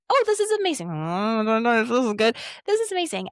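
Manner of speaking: mock delight flipping to worry and back; swinging excitement. Acting out the emotional wave for the listener, swinging between delight and worry.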